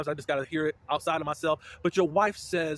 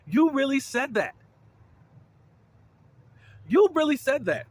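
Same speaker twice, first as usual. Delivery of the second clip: shocked disbelief; 10 semitones higher, 6 dB louder than usual. Real, not acted. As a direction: hammer home the outrage. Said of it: "I'ma say it like that".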